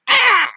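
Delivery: very high, loud yelp; cartoonish cry on being struck; pained scream. Chucky is hit after taunting.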